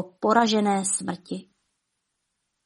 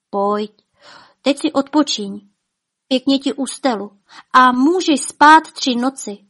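a higher, louder earnest plea to rest; pleading wife; 4 semitones higher, 4 dB louder than usual. Acted. Wife begging her husband to sleep, pleading. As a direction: coax him to bed, begging. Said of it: "prosila hromnička".

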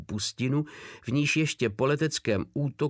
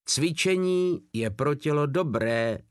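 usual speaking voice

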